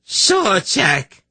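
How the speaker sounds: high anguished cry of a name; sudden piercing cry of grief; shriek. A narrator's reading. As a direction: shriek the name in horrified loss. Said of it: "Kabat suddenly shrieked".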